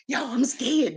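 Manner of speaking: acted tearful fear. Young bride driving away from grandpa, acted tearful fear. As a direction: whimper it lightly, as if tearful. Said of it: "tears flying. And I'm saying".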